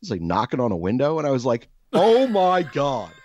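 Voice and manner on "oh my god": loud, high, long drawn-out retold exclamation; exasperated disbelief. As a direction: stretch it out in exasperation, pitched way up. Exasperated reaction to clueless owner.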